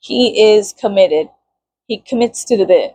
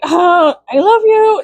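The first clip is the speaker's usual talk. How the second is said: mock sweet coo; 9 semitones higher, 3 dB louder, much slower than usual. Mock romantic line, laughing.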